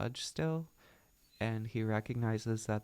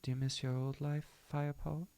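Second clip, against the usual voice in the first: put-on higher-pitched British-accented question; 5 semitones higher than usual. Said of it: "reading her dialogue in a British accent".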